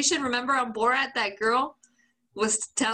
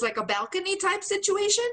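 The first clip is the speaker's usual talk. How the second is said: ordinary talk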